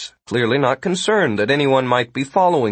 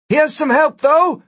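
high, raised calling voice; 5 dB louder than usual. A narrator's reading. Gwen offers help from overhead, calling down. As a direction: call out to someone below.

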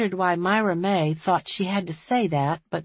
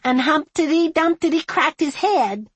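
put-on high, loud, slow childish singsong; delighted little girl; 10 semitones higher, 5 dB louder, slower than usual. A narrator's reading. Little girl bouncing on sheriff's knee, delighted. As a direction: recite proudly like a small child. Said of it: "She wriggled with delight".

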